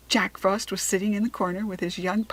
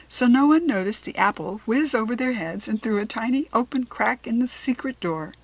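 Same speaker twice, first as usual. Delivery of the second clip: plain reading voice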